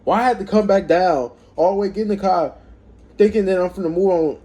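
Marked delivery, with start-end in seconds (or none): none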